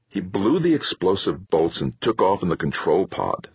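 ordinary delivery

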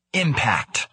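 usual speaking voice

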